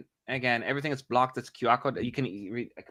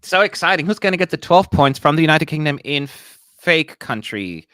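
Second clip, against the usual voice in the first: mock excitement; 8 dB louder than usual. Real, not acted. Mock hype over results.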